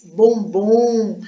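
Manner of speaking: "bombom" slowly drawn out; drawn-out word. Shown as a demonstration. Teacher sounding out the stress, drawn-out "bombom". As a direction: stretch "bombom" to show its stressed last syllable.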